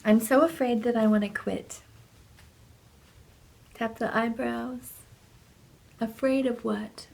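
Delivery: ordinary talk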